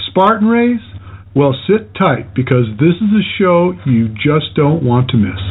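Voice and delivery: loud, punchy show intro read; radio announcer. Acted. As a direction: announce it like a radio host over music. Pre-recorded show intro over music.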